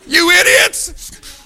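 high, loud, slow laughing insult; laughing mock yell. Lazarus yelling at his sisters as they unwrap him, laughing. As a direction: bellow it through laughter.

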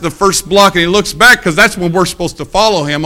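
usual speaking voice

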